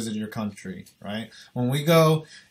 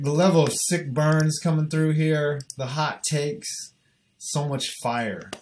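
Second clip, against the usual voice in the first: ordinary talk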